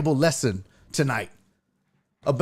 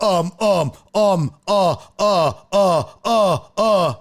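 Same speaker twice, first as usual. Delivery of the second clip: mock stammering; 2 semitones higher, 5 dB louder, slower than usual. Mocking flustered guest filler sounds.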